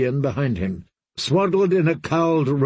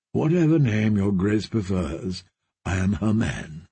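put-on friendly young lord, deep and relaxed; genial knight; 4 semitones lower than usual. Dre voiced in the story.

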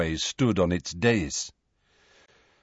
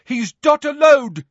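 high, loud shout; shout from above; 8 dB louder than usual. A narrator's reading. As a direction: yell it down to them at a very high pitch. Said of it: "shouted a voice from above them".

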